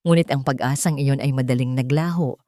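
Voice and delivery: plain reading voice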